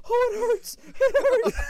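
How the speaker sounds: high, loud wail of agony; mock agony, crying out in pain. Husband acting out wife's cramp, pained wailing.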